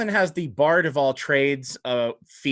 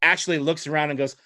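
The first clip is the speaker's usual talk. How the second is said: usual speaking voice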